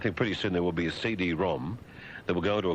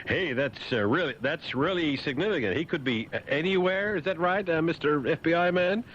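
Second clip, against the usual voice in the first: high, incredulous sarcastic questioning; mocking sarcasm; 10 semitones higher than usual. Host taunts the FBI man, high mocking sarcasm. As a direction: needle them with high-pitched mock admiration. Mock praise of FBI statement.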